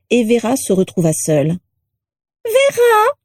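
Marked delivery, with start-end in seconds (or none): 2.4-3.1 very high, piercing screech; rattle-voiced portrait. A narrator's reading. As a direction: call out in a high, grating rattle of a voice. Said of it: "une voix de crécelle".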